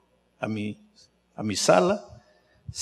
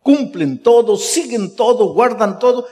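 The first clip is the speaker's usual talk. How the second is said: fervent preaching; 9 semitones higher, 5 dB louder than usual. Acted. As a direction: drive the point home, building. Preaching climax.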